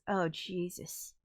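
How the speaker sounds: ordinary talk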